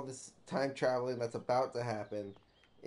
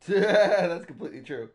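laughing; 6 semitones higher, 13 dB louder than usual. Real, not acted. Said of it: "That's true".

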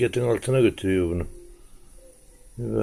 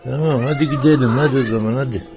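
higher, louder call to a pet; coaxing call; 5 semitones higher, 4 dB louder than usual. Real, not acted. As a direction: call the cat along, urging it to come. Kediyi gelmeye çağırıyor. (kediyi çağırır gibi, yüksek sesle)

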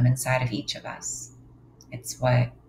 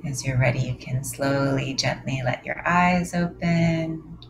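guided meditation. Acted. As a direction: lead them gently into stillness.

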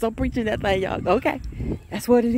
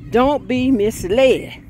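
slow, emphatic, louder warning; 4 semitones higher, 5 dB louder, much slower than usual. Real, not acted. Preacher warning the church, slow and emphatic. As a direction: spell it out word by word.

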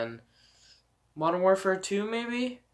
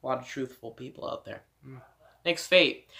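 ordinary delivery

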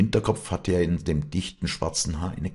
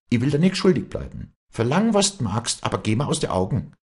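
high, brisk dialect voice; Walli, resolute and cutting; 8 semitones higher, 4 dB louder than usual. A narrator's reading. Walli paying off Vinzenz to be rid of him, high and resolute. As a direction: settle accounts firmly, pitched high.

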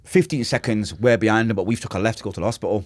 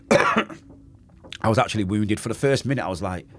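ordinary talk

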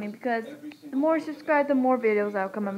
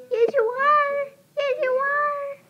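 pet talk; 12 semitones higher, slower than usual. Real, not acted. Praising her dog Max.

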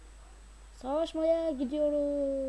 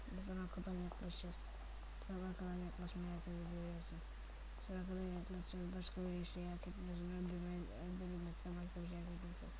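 menacing hushed taunt; 5 semitones lower, 15 dB quieter, faster than usual. Pretend. Sneaking up on enemy with knife.

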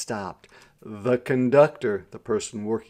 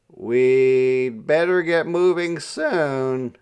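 lightly acted, loud, drawn-out remark; voiced passenger; 3 semitones higher, 7 dB louder, slower than usual. A narrator's reading. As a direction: stretch it out loudly as an uneasy passenger.

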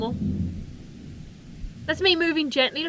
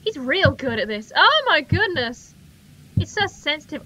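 ordinary delivery

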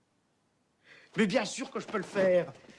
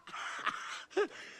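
laugh; 10 semitones higher, 4 dB quieter than usual. Acted. Laugh without words.

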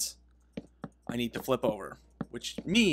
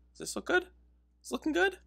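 high hopeful question; faster than usual. Real, not acted. Asking viewers about the house.

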